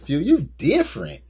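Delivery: high, loud mock accent saying different with a Y; imitating with a Y. Teasing the nephew about his shoes, a high mock imitation. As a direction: tease your nephew with his line. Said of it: "said different with a Y".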